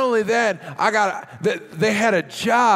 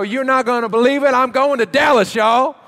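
loud, higher hammed-up thrill over a layover in Dallas; mock excited bragging; 5 semitones higher, 6 dB louder than usual. Preacher mocking a friend bragging about a Dallas layover, loud and hammed-up. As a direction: play up an overexcited traveler bragging. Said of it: "if you were to go tell people that story".

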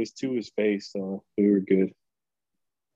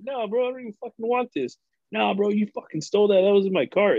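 high-pitched imitation of bickering shoppers; mock squabbling; 9 semitones higher than usual. Shoppers squabble over a cart, mock indignant.

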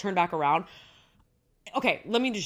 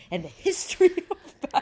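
laughing; 9 semitones higher, 3 dB louder, faster than usual. Real, not acted. Laughing while recapping show.

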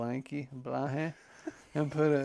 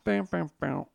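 higher, punchy "bam bam bam"; imitated fanfare; 4 semitones higher, 4 dB louder than usual. Capping the Contour Airline plug, mock fanfare. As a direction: sound out a little jingle sting. Vocal jingle after airline name.

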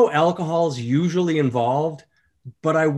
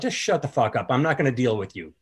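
normal talking voice

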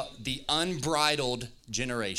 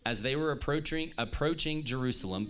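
normal talking voice

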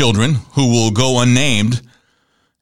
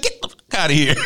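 laughing quote. Retold or imitated. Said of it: "He's like".

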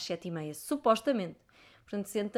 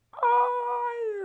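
long, high-pitched swooning cry; fangirl squeal; 6 dB louder than usual. Real, not acted. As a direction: squeal like an overwhelmed fan. Overwhelmed remembering the concert.

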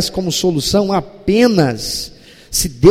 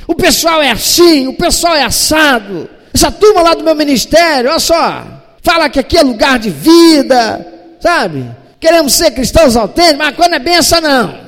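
loud, higher self-righteous griping; whiny complaint; 7 semitones higher, 4 dB louder than usual. Retold or imitated. Elias complaining to God about the church, loud and self-pitying. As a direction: vent like a bitter churchgoer. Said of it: "igual a muita gente religiosa costuma fazer".

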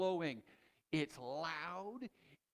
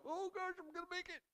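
mock panic; 13 semitones higher, 4 dB quieter, faster than usual. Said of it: "I go".

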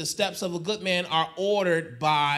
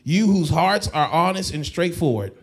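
loud, driving preacher's plea; full-force preaching; 6 dB louder than usual. Acted. Pastor pleading with the congregation, preaching loud. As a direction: lift it up and push the congregation.

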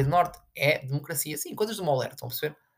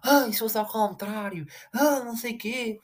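mocking whiny voice; 7 semitones higher, slower than usual.

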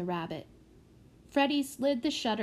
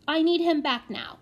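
put-on high, louder, insistent child; anxious; 6 semitones higher, 5 dB louder than usual. A narrator's reading. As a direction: plead like a worried small child. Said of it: "asked the prodigy anxiously".

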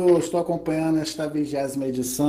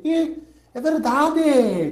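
imitating Daniel; 10 semitones higher, 3 dB louder, much slower than usual. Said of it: "Aí ele".

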